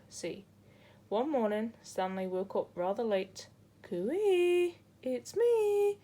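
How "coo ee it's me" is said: high, loud sing-song call; cheerful high coo-ee across the reef. A narrator's reading. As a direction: call out to find friends. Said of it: "he called to his friends".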